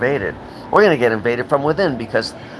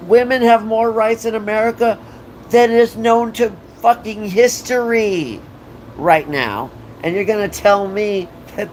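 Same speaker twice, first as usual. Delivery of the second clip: high, rising shouted rant; heated rant; 9 semitones higher than usual. Real, not acted. Ranting to viewers about women's rights, high and heated. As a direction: climb into a shout.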